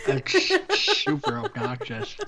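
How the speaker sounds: laughing tipsily through it. Real, not acted. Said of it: "when you've been drinking".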